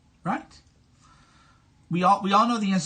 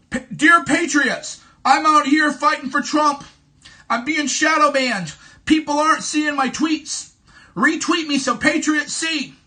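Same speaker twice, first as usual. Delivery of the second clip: higher, louder, whiny plea for retweets; mock pleading tweet; 6 semitones higher, 5 dB louder than usual. Streamer mocking a begging influencer tweet, higher and louder. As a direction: ham up a desperate begging influencer. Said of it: "Have you ever seen".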